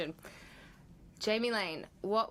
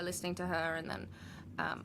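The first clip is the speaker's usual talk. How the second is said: normal talking voice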